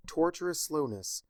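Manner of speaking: plain reading voice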